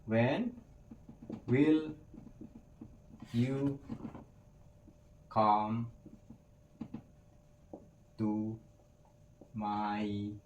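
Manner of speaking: each word spaced out with long pauses; word by word, for learners; slow word-by-word model. Shown as a demonstration. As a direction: spell the question out one word at a time. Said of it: "teacher models the question word by word".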